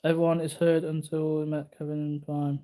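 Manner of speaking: ordinary delivery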